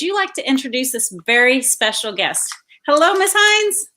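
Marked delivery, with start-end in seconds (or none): 2.9-3.9 high, bright hello, pitched up and louder; a high, eager welcome; excited greeting. Real, not acted. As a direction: greet the guest with a big lift. Greeting her mom as guest.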